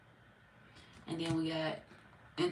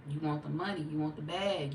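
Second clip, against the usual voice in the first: usual speaking voice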